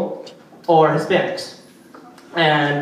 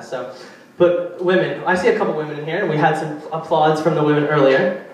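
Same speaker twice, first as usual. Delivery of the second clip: normal talking voice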